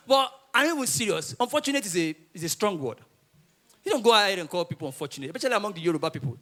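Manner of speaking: ordinary delivery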